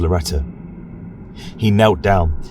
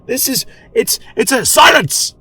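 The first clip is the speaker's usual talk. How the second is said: outraged shriek; 14 semitones higher than usual. Acted. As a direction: shout in disbelief at the decree.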